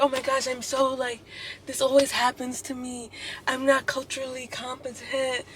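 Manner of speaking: high, soft, self-pitying professor voice; playing the victim. Professor excusing himself to the student, mock victim voice. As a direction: put on a helpless poor-me whine. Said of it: "he was definitely given victim".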